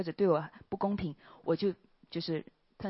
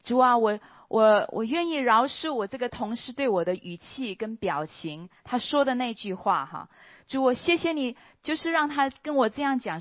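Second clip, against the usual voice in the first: loud, high fervent prayer of forgiveness; earnest prayer; 5 semitones higher, 6 dB louder than usual. Retold or imitated. Praying on the walk home to forgive a colleague, loud and earnest. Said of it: "我就说".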